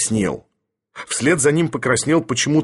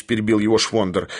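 plain reading voice

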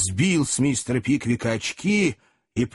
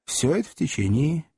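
plain reading voice

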